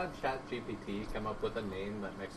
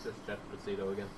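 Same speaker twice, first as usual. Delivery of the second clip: mumbled; 4 semitones lower, 7 dB quieter, faster than usual. Real, not acted. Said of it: "What's that?".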